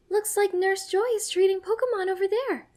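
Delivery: high, loud calling voice; yelling. A narrator's reading. Serena pointing at the van, yelling to Ash. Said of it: "Serena yells".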